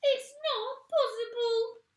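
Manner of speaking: very high, loud, slow panicked cry; panicked old woman. A narrator's reading. Mrs Twit panicking at her husband's words, high and loud. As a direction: wail it in disbelief.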